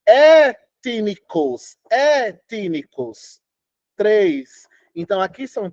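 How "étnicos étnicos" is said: "étnicos" very slow, with a long held É; "étnicos" stretched out, falling after the opening é; stressed-syllable demonstration. Shown as a demonstration. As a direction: chant "étnicos" block by block, stressing the first. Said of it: "Como é que eu digo".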